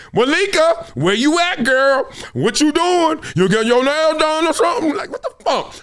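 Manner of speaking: high-pitched incredulous challenge; sing-out, high and indignant; mock calling out. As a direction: call her out like she's hiding. Hailing absent Malika, mock confrontation.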